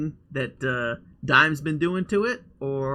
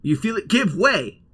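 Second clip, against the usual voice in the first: ordinary talk, nothing marked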